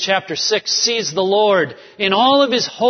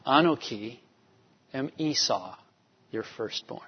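hushed guilty confession; 6 semitones lower, 10 dB quieter than usual. Retold or imitated. Jacob's guilty lie to Isaac.

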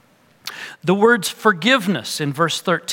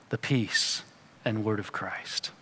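whispered ending; 6 semitones lower, 10 dB quieter than usual. Real, not acted. Trailing whisper.

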